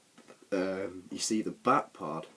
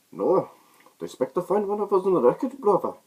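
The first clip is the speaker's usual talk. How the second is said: much higher, loud, fast muffled villain voice; Bane impression; 12 semitones higher, 9 dB louder, faster than usual. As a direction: boom it out like the masked villain. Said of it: "this is how he sounds".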